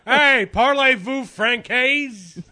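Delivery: put-on very high, loud, slow French accent; hammed French accent. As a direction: drag it out in a mock French accent. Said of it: "You can't do a French accent?".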